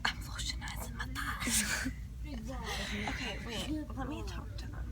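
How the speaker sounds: soft unvoiced whisper. Real, not acted. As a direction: whisper so nobody wakes. Whispering near sleeping campers.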